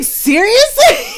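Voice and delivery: high, drawn-out incredulous question; mock disbelief. Reacting to an aunt agreeing, mock drawn-out disbelief.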